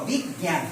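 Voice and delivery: বিজ্ঞান with জ্ঞ as a nasal g. Shown as a demonstration. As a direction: say বিজ্ঞান like big-gyan, g through the nose. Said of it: "গয়ের মতো". (বিজ্ঞান-এর জ্ঞ নাকি গয়ের মতো)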